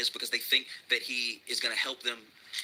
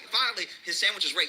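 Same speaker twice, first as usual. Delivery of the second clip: ordinary talk, nothing marked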